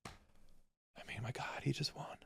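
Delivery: breathy hushed alarm; whispered dismay. Retold or imitated. Telling Marla about the election win, whispered. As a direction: whisper it as if it's a disaster.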